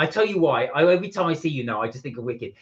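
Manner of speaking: ordinary talk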